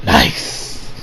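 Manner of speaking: very low, unvoiced whisper; whispered nice. Fan approving Blade Runner look, whispered low. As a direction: breathe it approvingly. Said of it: "So I was like".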